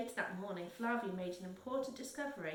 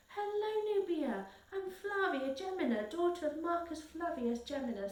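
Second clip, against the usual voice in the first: bright-pitched, emphatic greeting; high, keen girl's hello; eager introduction; 8 semitones higher, 4 dB louder than usual. A narrator's reading. Girl eagerly introduces herself to newcomer.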